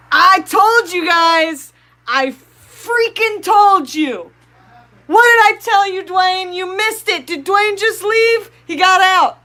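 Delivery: high, loud I-told-you-so yelling; triumphant shout. Real, not acted. Streamer yelling to viewers that she predicted it, triumphant. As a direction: crow it, you called it. Gloating that fish got out.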